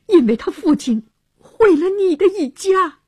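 high, heated outburst; angry accusation. A narrator's reading. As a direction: hurl the accusation. Angry brother accuses suitor's father.

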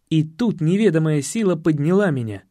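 usual speaking voice

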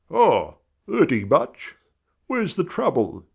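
a touch of a brisk doctor. A narrator's reading.

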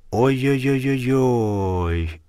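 slow drawn-out ow-ow in pain; pained groaning. Acted. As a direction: moan it out, clutching a bruised knee. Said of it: "схватился за ушибленное колено".

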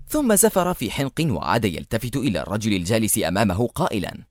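ordinary delivery

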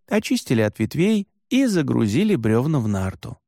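ordinary delivery, nothing marked

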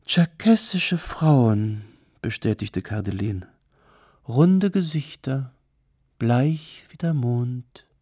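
high, slow, drawn-out showy delivery; theatrical hostess, extolling. Acted. Kardelin praises Circassian women to a client, theatrical and lingering. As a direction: savour each word grandly, stretching it out.

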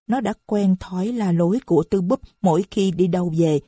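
ordinary delivery, nothing marked

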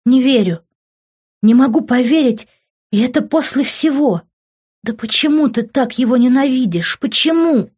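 high, loud, upset protest; distraught disbelief. A narrator's reading. Mother's distraught outburst at husband. (потрясённо, с отчаянным возмущением)

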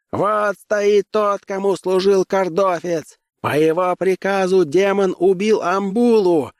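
high, sustained accusing harangue; rabble-rousing cry. Acted. Same agitator inciting the crowd. (обличительный выкрик высоким голосом)

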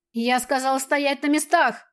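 slow, commanding roar; thunderous growl. A narrator's reading. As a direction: bark the order, slow. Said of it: "громовым голосом прорычал в ответ командир". (громовой рык)

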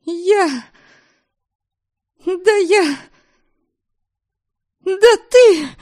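high, strangled little squeak; choked squeak. Acted. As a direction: squeak like a half-strangled mouse. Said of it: "писк полузадушенной мыши".

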